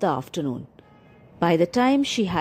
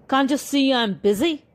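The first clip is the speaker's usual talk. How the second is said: barked; 6 semitones higher than usual. A narrator's reading. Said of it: "barked the dog".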